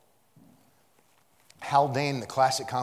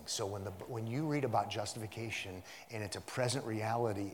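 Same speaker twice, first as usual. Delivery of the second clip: normal talking voice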